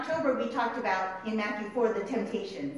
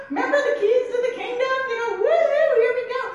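loud, high, mock-giddy reminder; Peter; 12 semitones higher, 8 dB louder, faster than usual. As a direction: whoop with hammed-up excitement. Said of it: "Peter's like".

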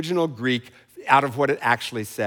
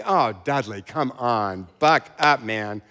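divine eye roll; 5 semitones lower, slower than usual. Pretend. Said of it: "I need a divine eye roll".

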